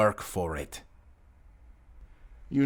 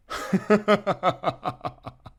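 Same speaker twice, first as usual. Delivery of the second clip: high, drawn-out villain cackle that falls away; evil laugh; 10 semitones higher than usual. Acted. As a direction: laugh off the insult with contempt. Villain laughing at his own threat.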